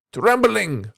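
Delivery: reading a short story aloud to the class; an expressive read-aloud, lightly voicing the dialogue. A narrator's reading. Teacher reads a story to class, lively read-aloud.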